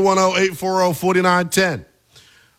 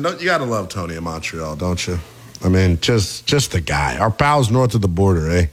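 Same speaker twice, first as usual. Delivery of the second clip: put-on low, hammy Canadian accent; mock Canadian accent; 9 semitones lower than usual. Host doing Canadian caller accent.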